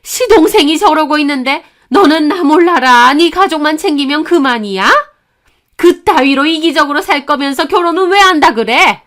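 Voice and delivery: high, loud mother-in-law rant; furious screeching tirade. Acted. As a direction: berate her with one indignant accusation after another. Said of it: "언성을 더욱 높이며 악을 썼습니다". (언성 높여 악쓰는 시어머니)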